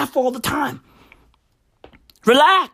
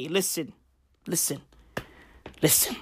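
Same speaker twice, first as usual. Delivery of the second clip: drops from soft to a whisper; soft repeated listen trailing into a whisper; fading to a whisper; 8 semitones lower, 14 dB quieter than usual. Acted. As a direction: hush them down, listen, listen.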